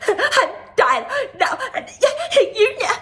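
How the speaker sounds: high, loud burst through laughter; hammed-up mock tantrum while laughing; mocking dramatic exit.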